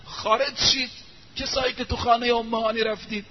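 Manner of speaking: high-pitched mock call to come out; retold shout. Ali calling the hiders out of Umm Hani's house, high mock shout. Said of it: "فریاد زد".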